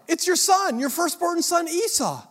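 high, loud proud announcement; loud, high-pitched eager son; eager Esau. Acted. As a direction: declare yourself the firstborn.

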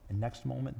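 ordinary delivery

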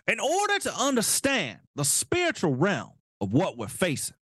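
hammed-up sermon about demons and communism; mock preacher voice. Host mocks the politician's quote about demonic portals, mock preacher voice. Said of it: "He goes on to say".

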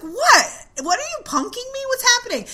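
alarmed disbelief. Retold or imitated. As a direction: voice shoots up in alarmed confusion. Panicked asking friends what's wrong.

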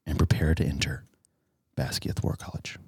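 ominous whispered ending; whispering. Acted. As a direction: hush it like a secret threat. Ominous end of warning.

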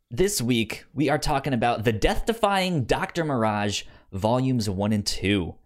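ordinary talk, nothing marked

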